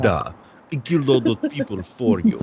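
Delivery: mock Russian accent. Playing a killer in the all-Russian show, Russian accent. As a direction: put on a jokey Russian villain's accent. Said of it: "like, a fucking Russian accent".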